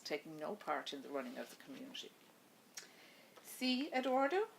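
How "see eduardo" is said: higher wife's voice calling his name; wife greeting her husband on the phone, higher voice. Acted. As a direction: lift the voice up into the wife's, asking. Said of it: "his wife's voice in his ear".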